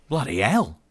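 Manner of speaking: flustered Australian outburst. A narrator's reading. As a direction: blurt it out in startled Australian. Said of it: "clearly flustered but obviously Australian".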